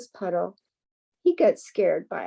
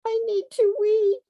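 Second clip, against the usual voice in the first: high, loud little mouse call; mouse shouting; 13 semitones higher, 5 dB louder than usual. A narrator's reading. Mouse interrupting the scared animals: high urgent squeak.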